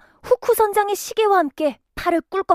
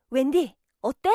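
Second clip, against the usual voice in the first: normal talking voice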